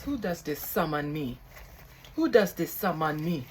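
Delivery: put-on loud, indignant spirit in an African accent; poor put-on African accent. Summoned billionaire's spirit demands who calls, poor African accent. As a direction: boom it out in a badly faked African accent. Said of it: "this is my poor acc-, accent".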